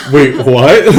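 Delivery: loud, laughing incredulity; shocked disbelief. Acted. Shocked at order to strip.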